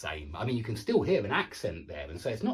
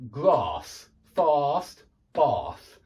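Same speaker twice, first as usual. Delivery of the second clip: southern British long ah in "grass, fast, bath"; slow, separated BBC English words; received pronunciation; 5 dB louder, much slower than usual. Shown as a demonstration. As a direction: draw out the ah as in southern England. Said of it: "what received pronunciation, B B C English".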